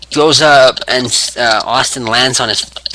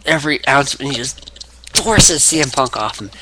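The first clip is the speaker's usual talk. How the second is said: usual speaking voice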